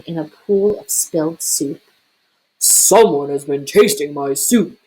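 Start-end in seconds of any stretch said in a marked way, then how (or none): 2.6-4.8 lower, louder papa bear roar. A narrator's reading. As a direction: roar like a big angry bear. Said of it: "he roared".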